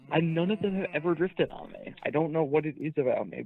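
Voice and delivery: ordinary talk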